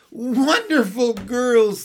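high, loud, drawn-out praise; proud. A narrator's reading. Mother praising baby Barbara, proudly. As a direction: gush it proudly. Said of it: "Missus Banks proudly".

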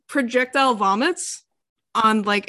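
ordinary delivery

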